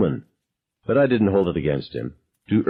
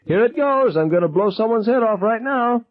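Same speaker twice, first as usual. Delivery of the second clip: manic threat. Acted. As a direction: shriek the threat like you mean it. Gunman announcing he will shoot.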